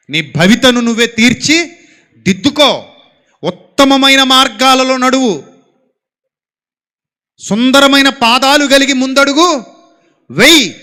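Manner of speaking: loud, slow exhortation; full-force preaching. Acted. Preacher loudly charges the young to walk the best path. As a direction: drive the charge home loudly.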